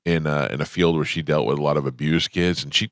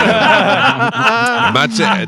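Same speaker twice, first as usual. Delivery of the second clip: laughing; 11 semitones higher, 6 dB louder, much slower than usual. Real, not acted. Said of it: "laughs through denial".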